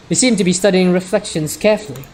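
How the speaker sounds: usual speaking voice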